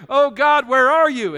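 loud, high cry up to God; desperate prayer. Acted. Praying to God when life is hard, loud desperate cry. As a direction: call out to heaven at full voice.

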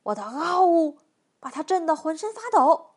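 loud bear bragging with a roar; big, blustering storybook bear; roared boast. A narrator's reading. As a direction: bellow proudly as the bear. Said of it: "熊咆哮道".

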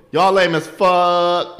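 long, loud drive-by heckle; mock jeer yelled from a car; yelled insult. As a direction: holler it high and drawn out.

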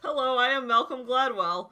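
giggling as you try the calm voice; laughing Gladwell impression. Cracking up while mocking Gladwell for a friend.

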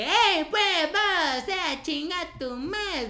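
sing-song Chiapaneco singsong with vos, drawn out; Chiapas accent. Retold or imitated. Imitating how people from Chiapas insult, high Chiapas accent. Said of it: "el chiapaneco".

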